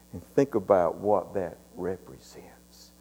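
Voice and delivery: hushed, reverent whisper; whispered reflection. Real, not acted. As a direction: whisper it to the church. Closing communion reflection.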